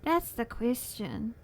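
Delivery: slow, mock-solemn musing; slow dramatic. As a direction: ponder it grandly.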